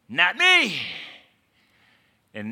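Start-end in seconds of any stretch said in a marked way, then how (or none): 0.1-0.8 high, loud hammed-up protest; mock boast. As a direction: shout it as an exaggerated brag. Acting Peter's earlier boast.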